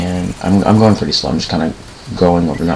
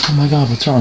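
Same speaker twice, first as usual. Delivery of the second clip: alarmed neighbor; 7 semitones higher, faster than usual. Retold or imitated. Voicing the alarmed neighbor who finds him.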